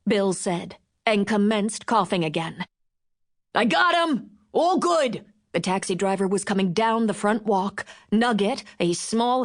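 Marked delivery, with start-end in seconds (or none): 3.5-5.3 calling out. Acted. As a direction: shout it across the yard. Driver calling out.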